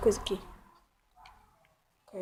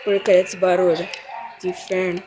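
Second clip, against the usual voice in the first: loud, exasperated cursing at the noise; annoyed outburst; 2 semitones lower, 13 dB louder than usual. Real, not acted. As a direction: grumble loudly about the racket. (reclamação irritada em voz alta)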